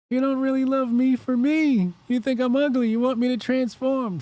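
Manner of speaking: high whiny put-on girlfriend voice; mock Mystique. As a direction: plead like a hurt shapeshifter girlfriend. Voicing Mystique again, high voice.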